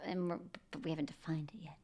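breathy unvoiced whisper, leaning in; whispered aside. Real, not acted. Friend whispers to co-hosts about the new guy. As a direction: drop to a whisper to confide.

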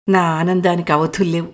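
ordinary delivery, nothing marked

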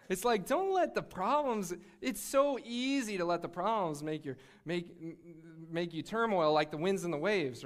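usual speaking voice

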